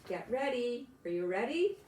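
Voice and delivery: usual speaking voice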